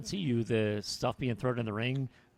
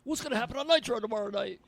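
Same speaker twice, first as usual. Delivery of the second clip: very high mock-announcer voice; hammed-up, high-pitched cliffhanger question; announcer imitation; 12 semitones higher than usual. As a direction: imitate a breathlessly hyping wrestling commentator. Mimics announcer's show sign-off question.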